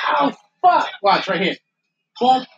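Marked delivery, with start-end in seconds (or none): 0.1-0.9 higher, louder mock cry of pain; imitating a wrestler yelling in pain; imitated pain yell. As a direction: yelp it like he just got hit. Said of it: "when he goes".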